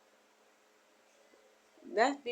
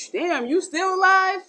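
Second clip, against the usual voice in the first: higher, louder mocking surprise; mock amazement; 8 semitones higher, 6 dB louder than usual. Scoffing at someone who outlived fifteen people, loud and higher. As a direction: jeer at them for still living.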